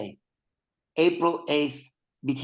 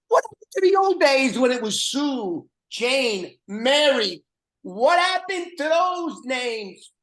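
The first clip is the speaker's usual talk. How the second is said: high, loud, exasperated complaint; mock outrage; 11 semitones higher, 5 dB louder than usual. Host mocks the guest's name in a high, loud whine. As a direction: whine about the good old names.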